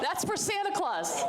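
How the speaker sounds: high, giggly voice through laughter; laughing. Real, not acted. Guest joking back at the host through laughter. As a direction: laugh the punchline out. Joke about sitting on lap.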